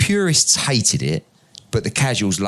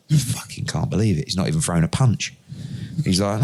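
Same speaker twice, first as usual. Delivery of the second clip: normal talking voice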